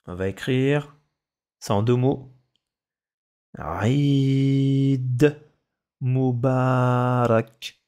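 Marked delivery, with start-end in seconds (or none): 3.6-7.8 Eid Mubarak drawn out very slowly for pupils to write; stretched dictation voice. Shown as a demonstration. Dictating words to a class.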